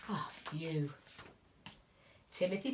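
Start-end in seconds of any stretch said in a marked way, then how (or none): 0.1-0.9 whispered, letting out relief; relieved whisper. A narrator's reading. Teacher reading to children, whispering with relief. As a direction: hush it as the scare passes.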